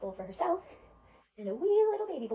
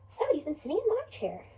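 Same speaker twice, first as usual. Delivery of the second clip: higher, lilting mother bear voice; Mama Bear; 4 semitones higher, 3 dB louder than usual. Acted. Mother bear seeing her chair was used, high and put-out. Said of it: "Mama Bear says".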